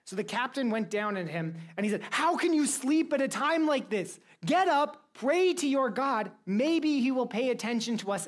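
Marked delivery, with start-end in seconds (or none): 2.1-8.3 raised, higher-pitched urgent rebuke; urgent demand. Acted. Captain to sleeping Jonah in the storm, urgent demand. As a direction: rouse a sleeper with loud, high urgency.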